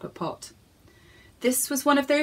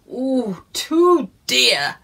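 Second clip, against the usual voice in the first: curt, louder gruff refusal; lightly voiced gruff husband; mild gruff no; 7 dB louder, much slower than usual. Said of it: "said Mister Pepperpot gruffly".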